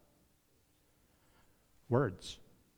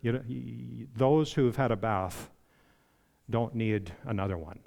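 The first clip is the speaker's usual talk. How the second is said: soft, lower, gentle correction; Jesus gently correcting Peter; kind and gracious; 5 semitones lower, 7 dB quieter than usual. Retold or imitated. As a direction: gently reassure an eager friend. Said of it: "kindly and graciously said to Peter".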